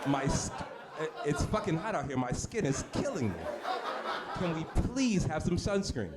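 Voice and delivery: lightly acted complaint to the master; slow, drawn-out plea for relief. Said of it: "asking for sunscreen by eleven A M".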